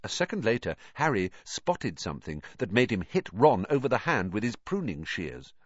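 plain reading voice

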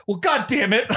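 a light hammed-up curse; mock frustrated Kirk. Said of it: "the Kirk's like".

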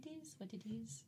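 very soft imitation Irish accent; mock Irish accent. Teasing friend about copying her catchphrase, soft Irish accent. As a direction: mimic a friend's Irish lilt, hushed. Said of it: "you always go".

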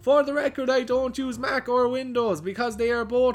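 high, soft voice of an offended poster; mock indignant poster. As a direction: act out the smug forum reply. Reading the angry poster.

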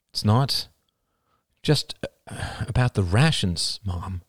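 ordinary delivery

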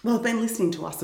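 normal talking voice

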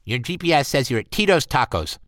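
high, tight clipped secretary; a tight-throated office assistant; pinched assistant voice. Acted. Assistant's voice again.

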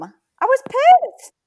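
cracking, squeaky indignant voice. Real, not acted. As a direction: voice cracks up high in outrage. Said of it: "Oh my God, my voice is cracked".

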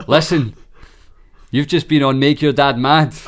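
[name]. Retold or imitated. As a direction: announce the wind-up like a radio host. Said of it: "I'm [name]".

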